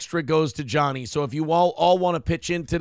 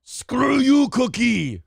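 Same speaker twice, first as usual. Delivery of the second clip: much higher, louder mock Arnold Schwarzenegger snarl; 8 semitones higher, 5 dB louder, much slower than usual. Host jokes the anchor's impression turns into Arnold. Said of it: "you're mixing up your impressions".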